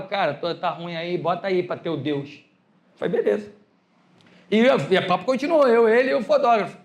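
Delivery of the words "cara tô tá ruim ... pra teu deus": low, soft, halting plea; subdued, slowing toward the end; retold downcast request. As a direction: imitate a friend calling when things are bad. De vez em quando me ligava.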